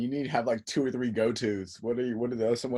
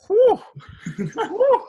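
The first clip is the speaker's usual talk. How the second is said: high, loud swooning whew; awed whew; 7 dB louder than usual. Retold or imitated. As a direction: whoop softened into an admiring whew, pitched way up. Awed by a beautiful voice.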